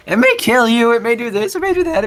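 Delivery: high, loud ad disclaimer; bright disclaimer hiding doom; cheerful ad with eerie menace. Retold or imitated. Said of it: "they, like, speed it up".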